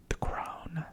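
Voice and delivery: usual speaking voice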